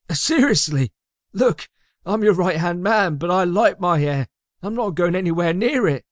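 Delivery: high-pitched, reluctant henchman voice; high, balking underling; protesting sidekick. A narrator's reading. Magnus balks at the order.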